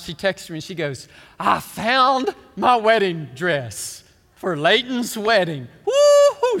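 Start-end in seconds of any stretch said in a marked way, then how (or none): 1.4-6.6 loud, slow, drawn-out announcement ending in a high whoop; hammed-up overjoyed wife; happy wife, imitated. Pastor acting out wife's text about the dress, happy and triumphant. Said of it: "She's so happy".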